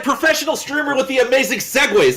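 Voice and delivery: high-pitched gushing fanboy praise; squealed mock admiration; mock hype. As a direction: tease the host with over-the-top hype. Mock-hyping the host's segue.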